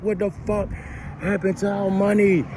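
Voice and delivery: high, frantic imitated girlfriend; girlfriend going off. Imitating his girlfriend panicking over the lost money. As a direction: mimic her panicking high and fast. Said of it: "she just going off".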